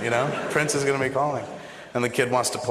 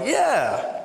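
eager yes; 11 semitones higher than usual. Retold or imitated. Eagerly agreeing to shoot.

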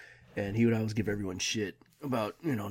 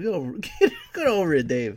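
higher, louder mocking jeer; taunting shout; 9 semitones higher, 8 dB louder than usual. Friends teasing Dave about his goatee, a laughing taunt. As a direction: jeer at him while laughing.

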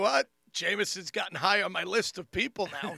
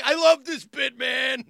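high, loud fan enthusiasm; gushing fan; 5 semitones higher, 10 dB louder, slower than usual. Retold or imitated. Fan watching a comedy bit on a phone, gushing loudly. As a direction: gush loudly like a thrilled fan.

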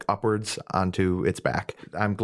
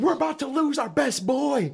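much higher, panicked wail; high wailing character voice. Acted. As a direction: cry it out in alarm. Character wails in combat.

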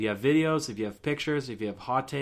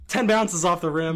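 higher and louder excited repeat; 6 semitones higher, 5 dB louder than usual. Real, not acted. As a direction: burst out in disbelief. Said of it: "That actually happened tonight".

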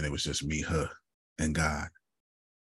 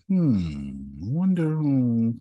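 slow, higher sly wondering drawl; mock musing listener; 5 semitones higher, much slower than usual. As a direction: tease the audience as they try to guess. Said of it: "you'll be like".

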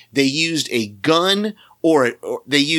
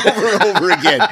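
laughing as he echoes the phrase; higher, louder, quicker, through laughter; 5 semitones higher, 5 dB louder, faster than usual. Real, not acted. Host echoing co-host's line about the voice, laughing.

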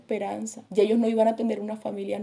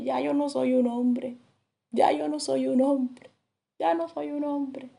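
lamento de eunuco; 4 semitones higher, slower than usual. Retold or imitated. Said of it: "lo que gritaba un eunuco".

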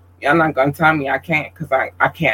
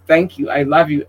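ordinary talk

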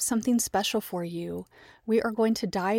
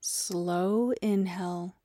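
guided meditation. Acted. Teacher leading listeners into Yoga Nidra, guided meditation. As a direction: guide them gently into rest, leaving space for breath.

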